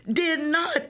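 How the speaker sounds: understated shouted retort; yell back. A narrator's reading. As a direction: fire back a denial. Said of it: "Imani yells back".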